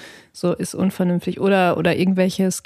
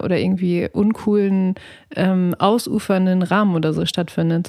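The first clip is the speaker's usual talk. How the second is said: ordinary delivery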